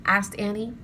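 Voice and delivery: ordinary delivery, nothing marked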